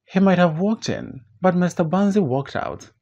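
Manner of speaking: normal talking voice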